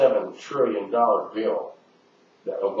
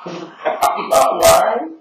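laughing, slow and much higher; slow amused laugh, much higher and louder; 7 semitones higher, 6 dB louder, much slower than usual. Real, not acted. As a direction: chuckle through it, insisting.

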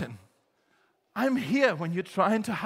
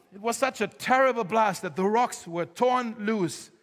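dramatic reading. Acted.

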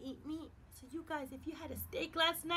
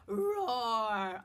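loud roar; 4 semitones lower, 4 dB louder than usual. Shown as a demonstration. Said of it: "And one loud roar".

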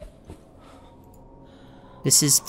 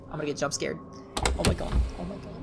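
ordinary talk

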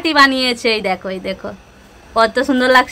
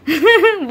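light laugh; 3 semitones higher than usual. Real, not acted. Mother laughs at son.

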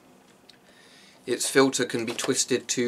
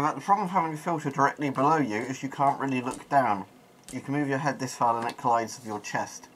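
muffled behind respirator; speaking inside sealed mask; through a gas mask; 3 semitones higher, 4 dB quieter than usual. Real, not acted. Seen 0: Full-face respirator worn over mouth the whole time; reference frames show it unmasked.

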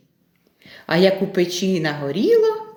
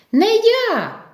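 high-pitched mouse call; calling out refusal; 13 semitones higher than usual. Acted. Vert refuses the rooster, calling out high. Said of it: "гукає верть".

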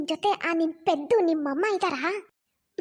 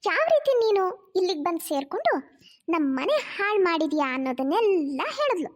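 scolding mother. Acted. Mother scolds scheming daughter.

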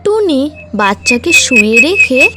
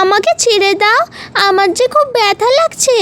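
frightened cry; 8 semitones higher than usual. Acted. Caught chick cries out high.

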